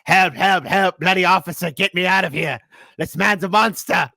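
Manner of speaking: high, loud mock cry for help in British accent; hammed-up British prisoner yelling for rescue; bad British voice. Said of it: "ask me to do a British voice".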